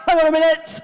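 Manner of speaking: loud, high protest; mock outraged shout. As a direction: call a halt, hammed-up and loud. Acted objection to reading a book.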